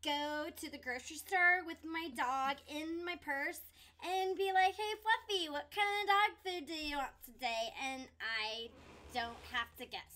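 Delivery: put-on bubbly, loud valley girl. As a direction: gush it like a ditzy valley girl. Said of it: "Do your Valley Girl voice".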